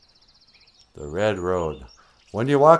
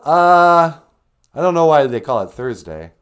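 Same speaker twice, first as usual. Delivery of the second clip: ordinary talk, nothing marked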